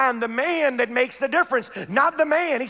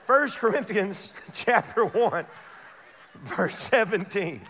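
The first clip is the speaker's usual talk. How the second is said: slow Bible reference with a laugh; slow laughing read; 5 semitones lower, 3 dB quieter, slower than usual. Shown as a demonstration. Preacher rereading the verse slowly to the congregation, slow with a laugh. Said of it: "Watch this".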